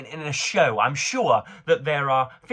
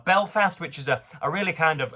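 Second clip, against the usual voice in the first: ordinary talk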